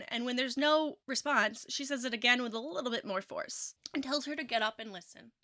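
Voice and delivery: normal talking voice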